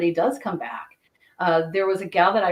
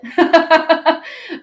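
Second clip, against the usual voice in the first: burst of laughter; 11 semitones higher, 8 dB louder than usual. Real, not acted. Laughs at her own joke.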